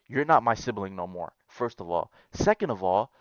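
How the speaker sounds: ordinary talk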